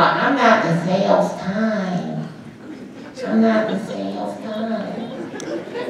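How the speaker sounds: slow, soft mock protest; imitating reluctant consultants. Voicing hesitant consultants who shy from selling, mock-reluctant. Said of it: "I know some of y'all".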